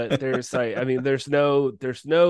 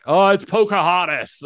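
mocking; 7 semitones higher, 7 dB louder than usual. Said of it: "mocking internet critics".

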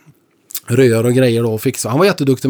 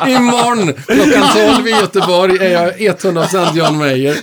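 higher, louder, laughing brag; laughing boast; 7 semitones higher, 4 dB louder than usual. Pretend. [name] brags he'll be fully [name], laughing. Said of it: "mock boast laughing".

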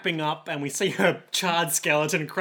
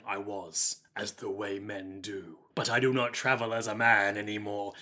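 low, soft, solemn Dracula; deep, measured vampire lord; 7 semitones lower, 4 dB quieter than usual. Acted. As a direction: intone it slowly as a grave count. Acts Dracula's grave reply.

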